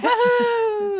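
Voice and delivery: high, loud laughing woohoo; excited cheer. Real, not acted. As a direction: cheer her on with a big laugh. Cheering friend finishing long book.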